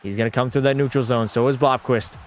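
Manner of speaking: hockey announcer calling the action; play-by-play call. Acted. Streamer calling his own hockey game as announcer. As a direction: call the rush like a TV broadcaster.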